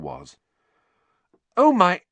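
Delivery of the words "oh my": high, loud shocked gasp of words; exclaimed. Acted. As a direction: blurt it out in alarm. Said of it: "Hermione exclaimed".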